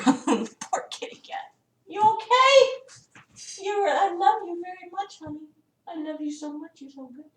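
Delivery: tender high cooing at the cat. Real, not acted. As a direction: coo softly to a scared pet.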